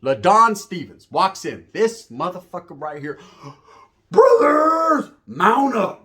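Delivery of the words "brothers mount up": high, loud drawn-out holler; mock rallying call. Inmate rallying his crew as a new man walks in, mock holler.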